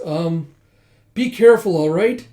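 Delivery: high, loud call after someone. Acted. Riri calling after Yona, high and loud. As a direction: call out to her as she leaves.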